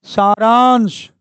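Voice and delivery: सारांश drawn out slowly. Shown as a demonstration. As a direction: let सारांश hang, syllable by syllable. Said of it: "सारांश होता है".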